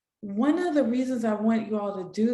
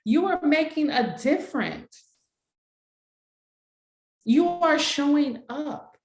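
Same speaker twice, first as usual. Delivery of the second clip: affirmation read; 2 semitones higher than usual. Shown as a demonstration. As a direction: speak each affirmation like a coach. Leads affirmations for the group.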